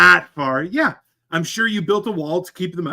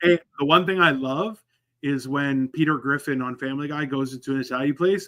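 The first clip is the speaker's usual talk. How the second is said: normal talking voice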